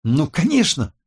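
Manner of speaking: high, irritated comeback; snappish retort. A narrator's reading. Tech expert retorts to worried colleague, snappish. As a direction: snap back, offended at being asked. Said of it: "огрызнулась Роза".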